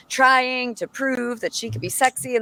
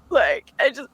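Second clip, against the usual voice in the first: ordinary talk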